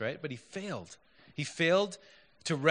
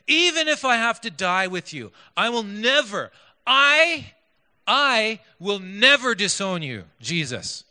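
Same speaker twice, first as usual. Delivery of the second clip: loud, high, emphatic vow; insistent; 5 semitones higher, 7 dB louder than usual. Retold or imitated. Preacher voicing Peter's vow to Jesus, insistent. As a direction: build a forceful oath, stretching the last words. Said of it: "He was insistent".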